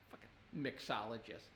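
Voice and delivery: very low, soft grumble; scornful mutter. Real, not acted. Comic sneering at the job title, low scornful mutter. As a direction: grumble it under your breath in disdain. Scornful aside after the quote.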